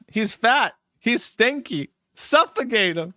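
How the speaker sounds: a slight mock of P P P's voice; a hint of P P P reading the line. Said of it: "in P P P's voice".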